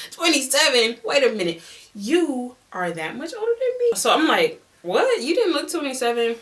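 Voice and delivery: high-pitched, incredulous echo; acted astonishment at a revelation; shocked disbelief. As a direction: react aghast, voice jumping up. Shocked echo of his age.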